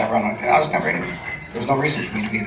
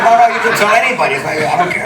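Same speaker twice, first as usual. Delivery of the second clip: fast, loud, higher and laughing; 8 semitones higher, 6 dB louder, faster than usual. Real, not acted. Waving off the interviewer's joke, laughing loudly. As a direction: brush it off through laughter.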